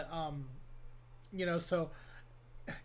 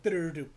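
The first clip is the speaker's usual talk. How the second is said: rough mouthed drum pattern; quick beatboxed drum roll and hits; vocal beat imitation; 6 dB louder, much faster than usual. Shown as a demonstration. Demonstrating an eleven sixteenths beat, makeshift beatbox. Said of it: "I'm not very good with the voice thing".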